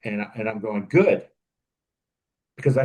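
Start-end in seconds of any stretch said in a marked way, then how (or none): 0.9-1.2 higher, louder bright approval; pleased good. Retold or imitated. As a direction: cheer yourself on. Approving his own mistake.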